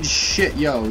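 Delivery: ordinary delivery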